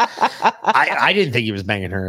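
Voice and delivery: laughing through the denial; chuckling as he protests. Real, not acted. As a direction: get the words out through real laughter. Laughing while denying the rumor.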